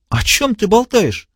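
high, slow sneering question; scornful villain. A narrator's reading. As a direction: sneer in disbelief. Diogenes voiced high.

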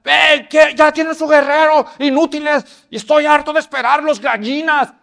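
high, loud taunting yell; giant yelling. Acted. Goliath yelling taunts at the Israelites. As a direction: bellow taunts at the cowards. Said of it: "empieza a oír la gritadera".

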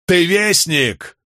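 high, drawn-out question; calling out. A narrator's reading. Man questions the storm god, calling out high. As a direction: throw the question up at the sky.

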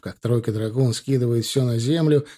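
ordinary talk, nothing marked

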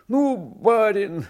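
high, loud, drawn-out call; alarmed cry. A narrator's reading. Coachman warns his master of a storm, alarmed cry. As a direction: cry out slowly to warn the master. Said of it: "закричал ямщик".

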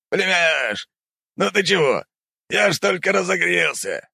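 high, puffing uncle voice; panting after a fight. Acted. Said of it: "пыхтя сказал дядя".

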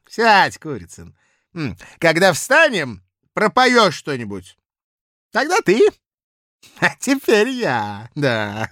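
put-on loud, high drunken bluster; drunken blustering official. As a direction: boast it loud like a tipsy clerk. Drunk Kozulin.

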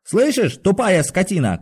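high-pitched furious insult; angry shout. A narrator's reading. Patient pounds the door at the guard, shouting angrily. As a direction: hurl abuse through the door. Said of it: "крикнул Иван Дмитрич".

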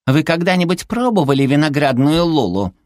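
high, cutesy challenge; huffy little creature. A narrator's reading. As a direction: challenge them in a sweet high voice. Зверёк защищает любимый напиток. (задетый высокий голосок зверька)

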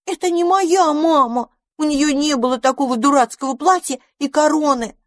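high-pitched squealing protest; frightened little mice crying out. A narrator's reading. Baby mice refuse a strange mother, frightened cries. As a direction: cry out as scared baby mice. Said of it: "закричали мышата".

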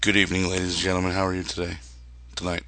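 low, soft, breathy sultry purr; sultry perfume ad. Acted. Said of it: "we got that sultry voice".

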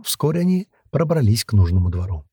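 usual speaking voice

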